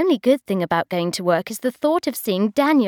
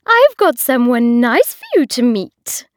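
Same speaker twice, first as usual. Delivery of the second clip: loud, much higher nagging voice; bossy hostess; 4 semitones higher, 6 dB louder than usual. A narrator's reading. Hostess nagging Bridget about marriage, high and loud. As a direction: lecture her like a bossy aunt. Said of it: "said Una".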